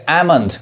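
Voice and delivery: एमंड with silent L; correct pronunciation. Shown as a demonstration. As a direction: go straight from vowel to M in एमंड. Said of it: "ये एल नहीं पढ़ाएगा". (एमंड, एल को खामोश रखकर)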